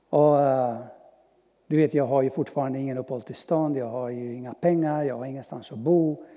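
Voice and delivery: normal talking voice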